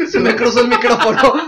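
laughing through the words; giggling while talking. Real, not acted. Tipsy joking about doubled mic.

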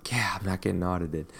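whispered aside. Retold or imitated. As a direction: whisper it conspiratorially. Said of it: "some people were like".

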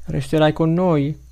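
put-on high, slow childlike plea; mumbling child. A narrator's reading. Child asking the rescuer to stay, mumbling. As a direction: ask timidly in a high child's voice. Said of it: "farfuglia l'altro".

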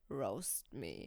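low, quiet mock match voice; deep, understated imitation of a match; mimicking dating-app matches. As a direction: drop it right down, mocking their opener.

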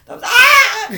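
words break into a high, loud shriek; pained scream. Real, not acted. Protesting to a friend that it hurt, pained scream. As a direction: start protesting, burst into a scream. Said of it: "you're just screaming about pain".

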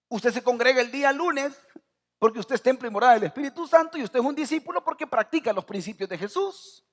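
high, loud pulpit preaching; rising fervent sermon voice. Acted. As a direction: preach it out to the congregation. Preacher raising to full force.

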